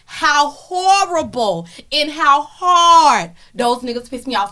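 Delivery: usual speaking voice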